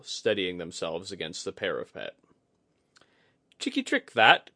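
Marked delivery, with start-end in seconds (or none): 3.6-4.5 chuckling. A narrator's reading. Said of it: "Kudaka chuckled".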